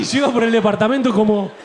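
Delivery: usual speaking voice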